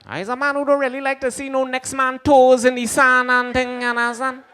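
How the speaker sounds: high, loud, heavy Jamaican patois; loud Jamaican uncle. Retold or imitated. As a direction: belt out the uncle's line in thick Jamaican. Repeat of uncle's line, loud.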